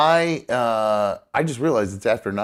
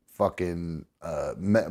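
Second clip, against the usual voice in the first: ordinary talk, nothing marked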